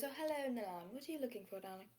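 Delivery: put-on fond mother speaking softly to a boy; soft tender mothering. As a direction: coo at him like a fond mother. Aziraphale addresses Nolan.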